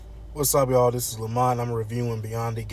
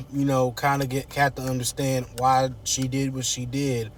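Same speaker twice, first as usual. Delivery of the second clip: ordinary talk, nothing marked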